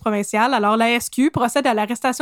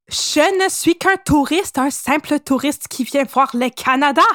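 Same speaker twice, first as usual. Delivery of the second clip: hammed-up, slow-starting fake German accent; put-on German accent, badly faked; slower than usual. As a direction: play an innocent tourist in a poor German accent. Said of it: "C'est pas super mon accent allemand".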